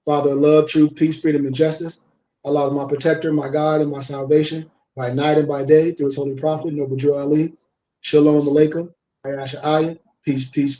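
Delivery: soft, devout prayer voice; closing prayer. Acted. Closing the stream with a devout prayer, softly.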